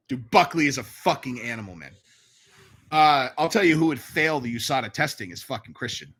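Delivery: usual speaking voice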